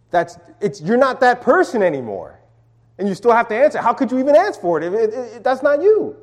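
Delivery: normal talking voice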